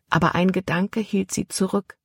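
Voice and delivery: ordinary delivery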